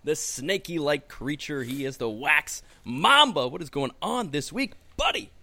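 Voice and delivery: showy build ending on a high squeaked greeting; hammed-up announcer intro. Host introducing the Wax Mamba, mock-grand announcer voice.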